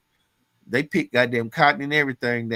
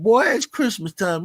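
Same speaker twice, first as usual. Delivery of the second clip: higher, brighter repeat of the boss's hype; the boss insisting, higher and more eager; cheerful boss; 8 semitones higher, 3 dB louder than usual. Retold or imitated. Mentor repeating his hype to a stunned worker, higher eager call. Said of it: "He said".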